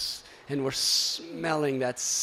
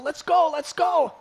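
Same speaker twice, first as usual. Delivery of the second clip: urging loudly, higher; calling out; 11 semitones higher, 8 dB louder than usual. Retold or imitated. Devotee calling others to the shore, urgent call. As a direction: hurry them up. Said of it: "he called out".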